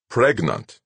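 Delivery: "Pregnant" spat out as a charge; accusing. A narrator's reading. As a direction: lay the word down like an accusation. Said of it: "He made the word sound like an accusation".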